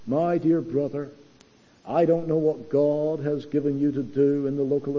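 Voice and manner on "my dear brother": ordinary delivery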